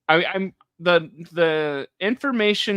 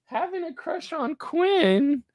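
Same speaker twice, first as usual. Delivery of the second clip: high, put-on girly voice; mock Leah; 11 semitones higher, slower than usual. Imitating Leah confessing her crush on Quinn, high mock voice. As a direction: ham up Leah's confession. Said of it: "Leah goes".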